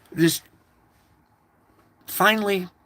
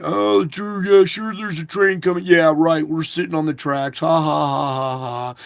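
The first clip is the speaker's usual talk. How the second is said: mocking driver; 3 semitones higher, 4 dB louder than usual. Driver mocking the man on the tracks, loud and sneering. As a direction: sneer at him as if he's a joke. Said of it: "he's mocking me again".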